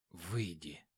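quiet, low, dying; weak plea. Acted. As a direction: ask softly with little breath. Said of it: "попросил он у неё". (тихо, слабо)

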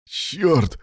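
high, startled exclamation; exclaimed curse. A narrator's reading. Rescuer warned about the rod, exclaiming. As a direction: curse in sudden alarm. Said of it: "воскликнул один из спасателей".

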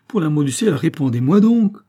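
loud, high, insistent pleading; desperate cry. A narrator's reading. Daughter imploring her distraught father, desperate loud cry. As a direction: beg at full voice for an answer. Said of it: "s'écria-t-elle".

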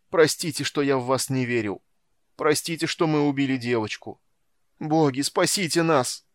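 sobbing plea. A narrator's reading. Merchant begs the gods to save them, sobbing plea. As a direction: beg loudly for forgiveness. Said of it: "рыдал Кевинард Ван Влит".

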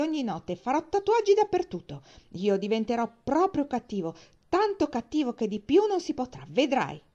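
put-on high, loud childish outburst; defiant tirade. A narrator's reading. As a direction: hurl a final challenge, building to high-pitched threats. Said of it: "per lanciare la sfida finale".

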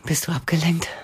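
whisper. Acted. Lover whispering to him in bed, hushed. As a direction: ask it under your breath. Intimate bedroom moment, whispered question.

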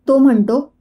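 plain reading voice

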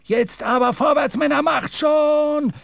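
high shout driving the men on; urging yell. A narrator's reading. Mate driving his boat crew after the whale, shouting. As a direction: yell them forward, stretching the end. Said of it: "schrie Stubb".

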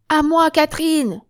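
high, loud call for backup. Acted. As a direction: call out to a comrade, short of screaming. First take of call to comrade.

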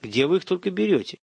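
lazily stretched question; drawled. A narrator's reading. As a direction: draw the question out. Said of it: "протянул Семён".

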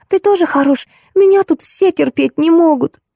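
irritated. A narrator's reading. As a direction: lash out in a high, offended voice. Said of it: "раздражённый Зайкин". (раздражённо, высоким обиженным голосом)

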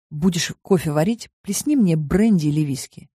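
plain reading voice

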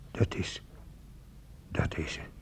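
voiceless whisper, fearful; whispered dread. Acted. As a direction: barely dare to say it. Afraid to say it. (fluisterend en angstig)

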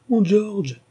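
high, loud, breaking cry of his name; the name bursts out in anguish. Acted. Father sees son in chains.

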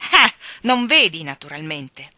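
loud sneer that drops away on naturalmente; mock her, biting; sarcastic. A narrator's reading. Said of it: "disse lui con tono sarcastico". (sarcastico, sprezzante)

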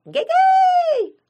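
very high, long-drawn loud call; answering halloo. A narrator's reading. Unseen voice answering from another room, high loud halloo. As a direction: halloo back, stretching the last syllable. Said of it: "Донеслось из одной из комнат".

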